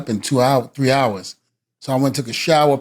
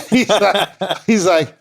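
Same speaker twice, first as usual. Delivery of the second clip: laughing; 5 semitones higher, much slower than usual. Real, not acted. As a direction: laugh breaking into the words. Laughing mid-story.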